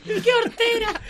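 high, loud burst through laughter; real laughter breaking into the words; laughing exclamation. Real, not acted. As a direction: exclaim it while cracking up. Family laughing at the anecdote.

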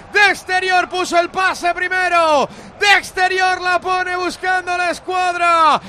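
long, shouted stadium goal call; goal celebration. Real, not acted. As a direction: scream the goal to the whole country.

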